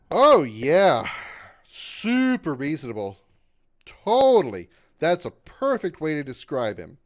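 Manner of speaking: loud mock agreement, drawn out; over-the-top sarcastic yes. Real, not acted. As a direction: pile on the fake praise. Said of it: "sense the sarcasm all over mine".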